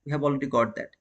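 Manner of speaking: usual speaking voice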